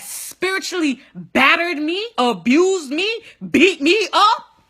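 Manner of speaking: high, loud, each blow punched out; slow, emphatic rising list; dramatic listing. Real, not acted. Dramatic list of school's blows.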